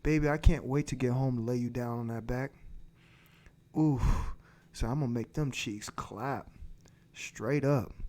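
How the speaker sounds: low, soft, fast seductive murmur; dirty talk demo. Pretend. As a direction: murmur it low in her ear. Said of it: "You tell her something like".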